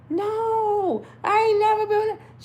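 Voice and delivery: high-pitched mock-child protest; panicked denial. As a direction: protest in a squeaky, hammed-up panic. Child denies mother's accusation.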